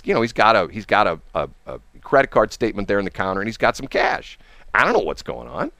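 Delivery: plain reading voice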